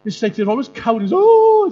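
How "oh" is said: very high, loud mock scared oh; hammed-up timid soldier gasp; cowardly yelp. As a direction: squeal like a frightened coward. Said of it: "they're always like that".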